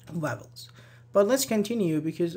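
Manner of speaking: ordinary talk, nothing marked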